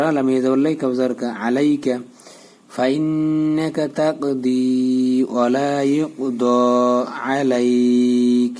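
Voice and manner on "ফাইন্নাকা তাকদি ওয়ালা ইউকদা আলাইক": slow recitation with humming "nn" in ফাইন্নাকা; joined, stretched recitation. Shown as a demonstration. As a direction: hold "di", "do" and a very long "lai" in আলাইক. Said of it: "লামে এক আলিফ টান দিতে হবে". (আলাইক-এর লামে টান দিয়ে তিলাওয়াত)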